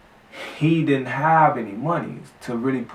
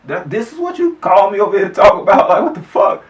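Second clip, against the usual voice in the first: loud, higher outraged retort; shouting back at the friend over the weed plan; 8 semitones higher, 9 dB louder than usual. Retold or imitated. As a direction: blow up at him in disbelief. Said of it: "So I'm".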